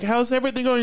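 high, sing-song caring parent voice; mock sweet parent. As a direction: coo it like a worried mom. Parents asking on the phone.

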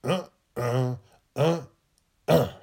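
mild put-on anger; mock anger. Shown as a demonstration. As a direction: grunt it out like a cross kid. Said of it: "Be mad and say".

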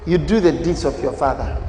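ordinary talk, nothing marked

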